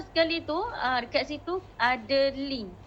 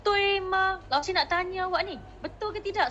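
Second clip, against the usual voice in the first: "Tui" pressed high for emphasis; emphatic questioning; 5 semitones higher than usual. Shown as a demonstration. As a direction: push "Tui" up to stress that you want to know. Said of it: "Lausha akan tekan perkataan tu".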